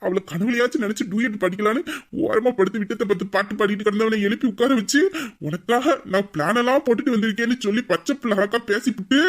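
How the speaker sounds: normal talking voice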